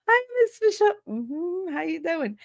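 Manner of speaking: put-on high, sweet, overly polite kid; innocent kid greeting. Kids greeting the teacher in the hallway, high and sweet. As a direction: play the suddenly angelic student.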